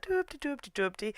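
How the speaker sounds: soft, high silly sing-song; aimless wandering ditty. Retold or imitated. Shizuka wandering around the festival, soft silly ditty. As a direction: hum nonsense syllables, pottering along. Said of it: "wandering around a little bit like".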